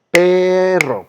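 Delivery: PEEE-rro, long held first syllable; stress on first syllable. Shown as a demonstration. Teacher quizzes students on perro's strongest syllable, drawn-out stress on PE. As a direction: lean hard and slowly on the PE of "perro". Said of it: "la sílaba que suena más fuerte".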